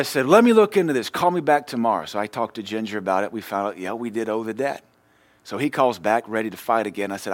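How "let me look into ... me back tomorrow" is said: much louder and higher, still fired up; loud heated reply. Retold or imitated. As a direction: snap it back up high and loud. Heated call with collector.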